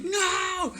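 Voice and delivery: very high cry of "No!"; desperate cry. Retold or imitated. Running down the steps toward his car, desperate cry. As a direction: yelp out in panic.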